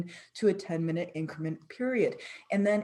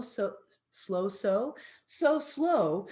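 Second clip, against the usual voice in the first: drawn-out slow; much slower than usual. Shown as a demonstration.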